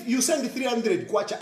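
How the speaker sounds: ordinary talk, nothing marked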